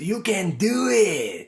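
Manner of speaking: high, slow cheering voice; Rob Schneider impression. Friend quoting a movie catchphrase, high slow cheer. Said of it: "who's the guy that always says".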